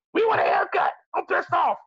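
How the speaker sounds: high mock protester voice; hammed-up high yell of selfish partiers; mock whiny shout. Mocking his own people, shouting high for a haircut. Mock complaint of partying crowd.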